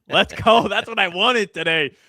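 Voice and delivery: pumped-up cheer; excited shout. Real, not acted. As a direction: fire up the crowd. Celebrating the win, pumped.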